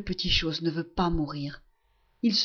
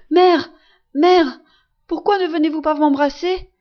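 much higher and louder, calling desperately for his mother; crying out loud; 7 semitones higher, 9 dB louder than usual. Acted. Sick son calls his mother to come kiss him, loud cry. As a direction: shout across the room to reach her. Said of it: "il se met à crier bien fort".

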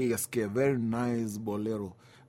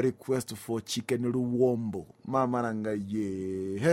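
usual speaking voice

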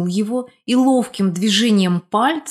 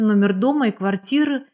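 usual speaking voice

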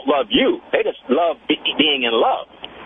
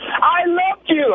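high, drawn-out mock protest; angry, imitated; 12 semitones higher, much slower than usual. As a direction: wail it slowly in imitation of an upset woman. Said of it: "then she got angry".

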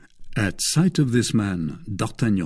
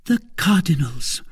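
higher voice, falling end; woman's confirming reply; 8 semitones higher than usual. A narrator's reading. Woman confirming whose bag it is, high and matter-of-fact. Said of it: "replied Madame Bonacieux".

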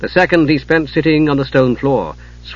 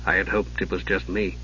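much lower and softer, hushed; quietly; 6 semitones lower, 12 dB quieter than usual. Acted. Steele answering his commander, quiet hushed reply. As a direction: admit it under his breath. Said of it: "he said quietly".

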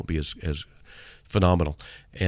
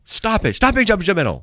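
much higher squeaky scolding; mock scold; 12 semitones higher, 5 dB louder than usual. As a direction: squeal it as a silly rebuke.

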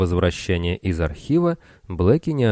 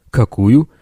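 menacing bark; 3 semitones higher, 7 dB louder than usual. Acted. Bill's menacing question.

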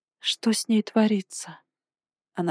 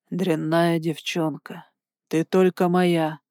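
sinister echo; 3 semitones lower, slower than usual. A narrator's reading. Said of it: "Эхом прозвучали в голове зловещие слова".

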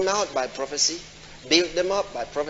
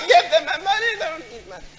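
mock screaming; 12 semitones higher than usual. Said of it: "crying there and screaming".

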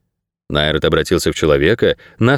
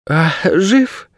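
put-on high girl's voice, lightly yawning; sleepy high-pitched question from the girl; a touch of a yawn, pitched up; 11 semitones higher than usual. A narrator's reading. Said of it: "зевая, спросила Кейса".

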